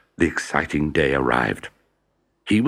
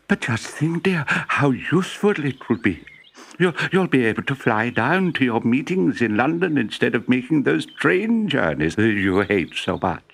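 wife's voice; 7 semitones higher than usual. A narrator's reading. Wife persuading husband.

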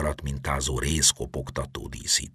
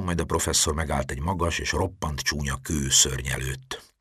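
usual speaking voice

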